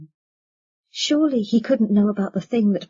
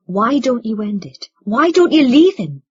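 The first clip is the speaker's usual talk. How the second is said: rising to a louder, higher plea; urgent demand; 3 semitones higher, 7 dB louder than usual. A narrator's reading. Son urges mother to leave his father, urgent demand. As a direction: press the question harder each time.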